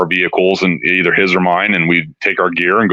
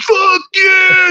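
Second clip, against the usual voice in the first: very high excited yell; stoked shout; 3 dB louder than usual. Retold or imitated. Singer hearing the band, high thrilled shout. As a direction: burst out thrilled at the noise. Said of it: "He's, like, so stoked".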